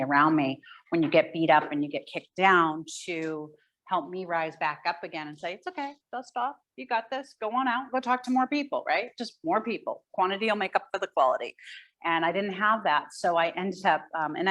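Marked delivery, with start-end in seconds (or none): 5.5-8.8 higher, gentle coaxing encouragement; encouraging pep talk. Pretend. Mentor cheering up a beaten-down agent, gently encouraging. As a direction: lift them back up like a kind mentor. Imagined supportive voice cheering her on.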